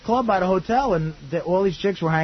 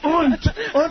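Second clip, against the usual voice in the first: mock German und; 11 semitones higher than usual. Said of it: "That's what I kept saying".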